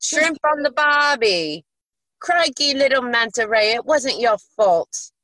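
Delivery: hammed-up Aussie catchphrase voice, starting high; over-the-top botched Australian accent; bad Australian accent. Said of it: "I can't do Australian".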